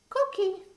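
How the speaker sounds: high squeaky "Cookie"; coqui frog call. Shown as a demonstration. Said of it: "conqui frog says".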